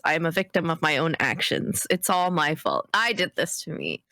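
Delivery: ordinary talk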